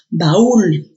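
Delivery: baúl split as ba-ÚL, punched ú; baúl in two clear syllables, force on ú; stress on the ú. Shown as a demonstration. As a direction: hit the ú of baúl, apart from the a. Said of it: "La A con la U se han separado".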